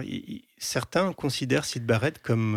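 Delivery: normal talking voice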